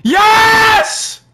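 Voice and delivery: high, loud victory yell; triumphant shout. Real, not acted. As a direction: cheer it out. Gamer cheering a kill.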